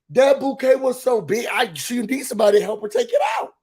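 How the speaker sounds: usual speaking voice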